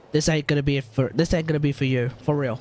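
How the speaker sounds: ordinary talk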